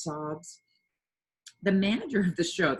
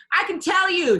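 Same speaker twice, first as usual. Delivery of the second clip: much higher and louder eager blurt; excited; 12 semitones higher, 10 dB louder than usual. A narrator's reading. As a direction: burst in eagerly. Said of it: "said Pippi excitedly".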